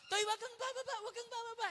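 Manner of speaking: high urgent call of the jeep driver; retold shout. Jeepney driver shouting at the boy not to get off, high urgent call. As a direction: yell it up high to stop the boy. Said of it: "Sinigawan ako".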